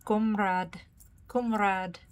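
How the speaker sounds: Comrade with a rounded kʊm and drawn ræd; imitating the game's comrade. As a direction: say Comrade like the game's accented soldier. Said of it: "They say comrade so particularly".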